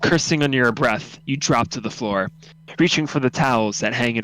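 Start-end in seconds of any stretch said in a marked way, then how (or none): none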